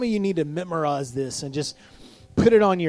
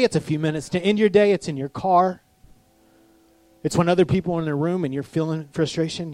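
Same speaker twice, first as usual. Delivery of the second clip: normal talking voice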